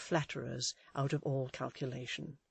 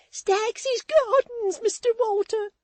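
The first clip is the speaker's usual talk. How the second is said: put-on high, loud, drawn-out desperate girl's voice; Susan's frantic plea; 11 dB louder, slower than usual. A narrator's reading. Maid begging Walter from the coach, frantic high wail. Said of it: "said Miss Nipper".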